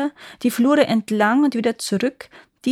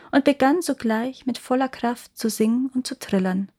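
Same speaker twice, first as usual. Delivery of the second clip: ordinary delivery, nothing marked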